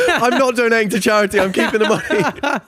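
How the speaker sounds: high, loud giggly confession; laughing mock greed. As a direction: laugh as you own up to keeping it all.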